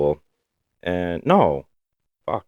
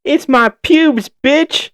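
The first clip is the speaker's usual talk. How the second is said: very high, loud, cartoonish taunting cackle; mocking bully laugh; 7 dB louder than usual. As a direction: ham up the bully jeering at him. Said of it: "the guys start laughing at me".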